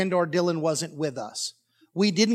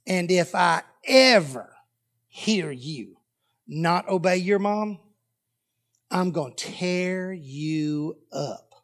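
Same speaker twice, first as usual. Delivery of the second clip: very slow, drawn-out threat; deliberate, word-by-word parental threat; stern warning; much slower than usual. Retold or imitated. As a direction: space the words out with menacing pauses.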